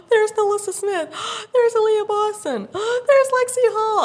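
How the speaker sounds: gasping, high loud squeals; gasp, then squeal each sighting, building; reenacted breathless fan shrieks; starstruck fangirl. Starstruck, spotting each player.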